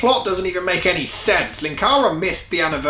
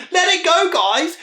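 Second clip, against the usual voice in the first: exasperated plea; 11 semitones higher than usual. Real, not acted. Pleading with the creators.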